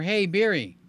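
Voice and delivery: lightly acted voice command. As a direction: talk to the phone like an assistant.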